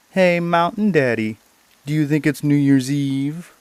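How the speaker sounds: high, loud mocking call; jeering cry. Street boys to a ragged old man in town, jeering. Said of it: "jeered at him and cried".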